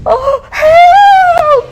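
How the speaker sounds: very high, loud desperate cry; cry for help. Acted. Witch chased by the dragon, loud desperate cry. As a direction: scream for help. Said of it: "cried the witch".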